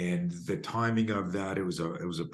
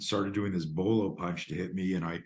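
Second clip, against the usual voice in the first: ordinary delivery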